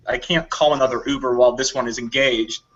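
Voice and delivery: usual speaking voice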